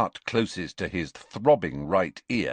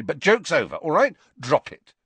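higher, louder, fed-up order; impatient; 5 semitones higher, 5 dB louder than usual. A narrator's reading. Harry tells Ron the joke is over, impatient. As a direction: shut the joke down firmly. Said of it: "said Harry impatiently".

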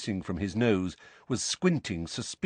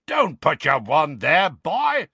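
loud, high roared warning; 7 semitones higher, 6 dB louder, slower than usual. A narrator's reading. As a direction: bellow a furious order at the boy. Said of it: "roared Moody".

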